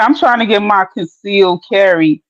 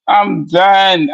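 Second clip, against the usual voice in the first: ordinary talk, nothing marked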